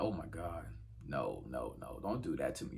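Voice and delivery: low, soft pained reaction; moaning at the screen as a fan; emotional groan.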